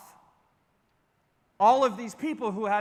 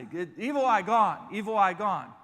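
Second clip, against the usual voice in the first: broken English. Retold or imitated. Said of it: "they say".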